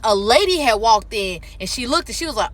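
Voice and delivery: ordinary talk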